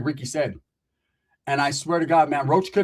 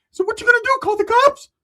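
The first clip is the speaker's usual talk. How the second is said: much higher, louder taunt; high mocking taunt; 7 dB louder than usual. Ricky taunting Roach about the cops, shrill. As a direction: sneer the challenge.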